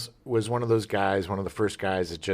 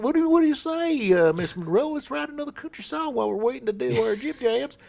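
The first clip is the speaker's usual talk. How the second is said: lightly voiced country guy; 13 semitones higher than usual. Voicing Caroline's husband.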